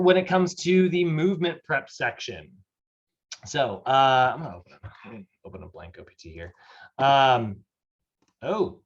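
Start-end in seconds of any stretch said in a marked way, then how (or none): 4.3-6.5 very soft, lower self-talk; talking to oneself. Real, not acted. Teacher opening a blank template, talking to oneself. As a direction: say it under your breath while busy.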